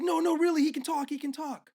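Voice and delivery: put-on high-pitched old lady insisting; mock desperate insistence. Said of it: "then she's like".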